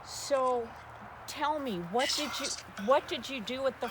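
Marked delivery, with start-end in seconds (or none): none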